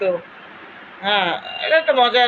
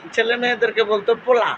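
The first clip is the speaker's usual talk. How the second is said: ordinary talk, nothing marked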